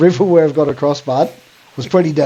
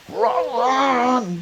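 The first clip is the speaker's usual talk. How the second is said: high, quick panicked cry of run; mock panic; 8 semitones higher, 5 dB quieter than usual. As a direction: mimic a mate losing his head. Panicking mate retold in story.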